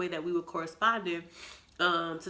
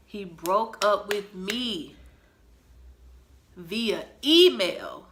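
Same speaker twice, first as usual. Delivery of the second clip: slow and much louder, each word hit; indignant emphasis; 8 dB louder, much slower than usual. Real, not acted. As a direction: spell out the outrage word by word. Outraged punchline to audience.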